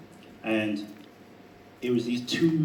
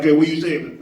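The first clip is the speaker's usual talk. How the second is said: louder, higher mock confrontation; rah-rah challenge; 5 semitones higher, 6 dB louder than usual. Stepping in on a street fight, mock confrontational challenge. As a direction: puff up with mock rah-rah bravado. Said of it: "step in of the rah rah".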